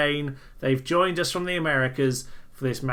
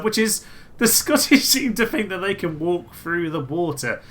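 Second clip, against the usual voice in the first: ordinary talk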